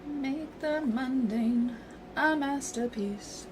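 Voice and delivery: tagline. Pretend. Reading the game's tagline slowly.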